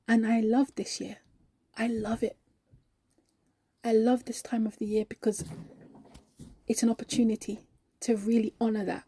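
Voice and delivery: hushed, heartfelt testimony with long pauses; reverent sincerity. Acted. Believer telling colleague why Christmas matters, reverent. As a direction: let each phrase settle softly.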